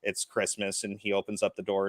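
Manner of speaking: ordinary talk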